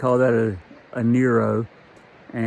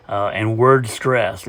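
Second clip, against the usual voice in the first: normal talking voice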